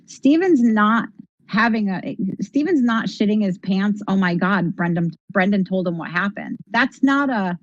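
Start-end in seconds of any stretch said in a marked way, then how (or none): none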